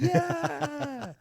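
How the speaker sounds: high, loud imitation of a cheering crowd. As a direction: whoop it up like mates cheering. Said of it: "everyone's, like, cheering".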